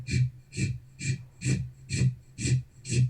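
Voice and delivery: rhythmic shushing dragging sound; short repeated shh, like feet dragged on carpet; imitated tracking noise. Storyteller imitates weird noise heard from upstairs, rhythmic shushing. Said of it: "this weird tracking noise".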